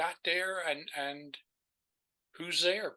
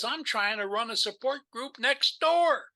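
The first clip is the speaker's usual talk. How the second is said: irritated neighbor; 8 semitones higher, 6 dB louder than usual. Retold or imitated. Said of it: "She says".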